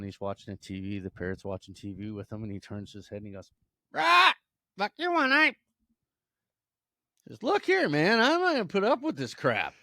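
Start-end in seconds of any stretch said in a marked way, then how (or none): 3.9-5.6 piercingly high, loud bird voice; squawking parrot. Acted. Parrot turning to owner at the TV, loud squawk. 7.4-9.7 loud, high, exasperated outburst; angry scolding. Acted. Owner fed up with his cursing parrot, scolding loudly.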